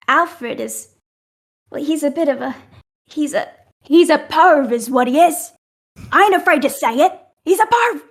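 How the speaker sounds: high, loud indignant rant; blunt outburst. Acted. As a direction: spit out the insult, no filter. Captain blurts out insult.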